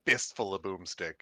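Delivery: ordinary talk, nothing marked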